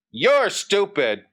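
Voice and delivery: high, defiant sneer; sneering bully. A narrator's reading. Bully retorts to Johnny before climbing, with a sneer. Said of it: "Billy said with a sneer".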